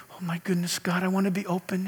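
soft, hushed inner prayer; timid prayer. Retold or imitated. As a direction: murmur it like a shy person. Passive quiet person praying.

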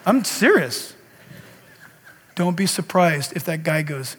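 ordinary talk

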